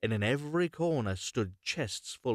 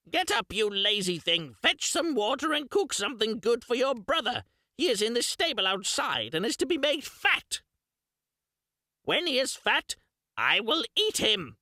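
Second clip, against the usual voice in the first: much higher, louder shrill witch voice; crying witch; 12 semitones higher, 4 dB louder than usual. Acted. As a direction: bark orders at the lazy girl. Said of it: "and cried".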